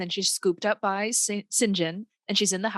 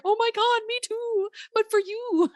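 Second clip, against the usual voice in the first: higher, hammed-up swooning reply; mock gushing lover; 11 semitones higher than usual. Mocking Rochester answering Jane, hammed-up high gush. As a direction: overdo the smitten gush.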